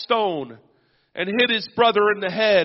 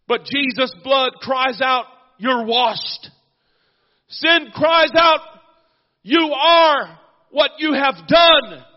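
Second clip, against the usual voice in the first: loud, rising preacher's call and response; full-force preaching; 5 semitones higher, 6 dB louder than usual. Acted. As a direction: build it up, shouting the cries of sin and blood.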